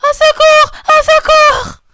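high, very loud screams; panicked child's shout, high and piercingly loud; crying for help. A narrator's reading. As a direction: scream for rescue at full force. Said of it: "en criant".

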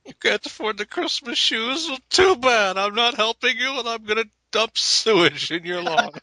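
high sneering villain. Retold or imitated. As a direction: taunt like a smug villain. Voicing Reaver villain.

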